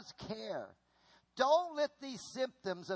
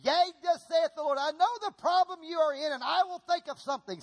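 loud, high-pitched booming proclamation; mock prophetic word; 9 semitones higher, 7 dB louder than usual. Young convert delivering God's word to the church, mock prophetic proclamation. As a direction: imitate an over-earnest young prophet declaring. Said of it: "he felt like God had had a prophetic word".

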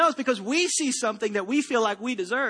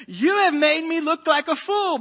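higher, louder, slow furious complaint; shouted; 6 semitones higher, 6 dB louder, slower than usual. Acted. Balaam shouting at his donkey, slow and loud. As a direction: let the words out slowly, loud and stung. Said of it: "Balaam shouted".

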